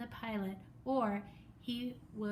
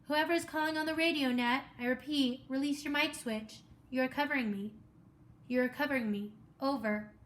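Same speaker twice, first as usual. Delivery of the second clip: louder, higher, firm radio call; an operator ordering the line cleared; radio controller; 3 semitones higher, 5 dB louder than usual. Acted.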